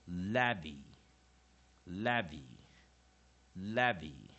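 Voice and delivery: "Levy" with a loud first syllable and weaker second; spaced drill of "Levy", front-stressed; strong-weak syllables. Shown as a demonstration. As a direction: punch the start of "Levy", ease off the end. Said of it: "weak syllable".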